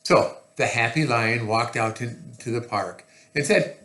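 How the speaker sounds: ordinary delivery, nothing marked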